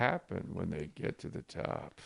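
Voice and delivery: suspenseful Dateline narrator voice; Keith Morrison. Acted. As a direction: read a children's book like a true-crime mystery. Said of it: "read it all as Keith Morrison from Dateline".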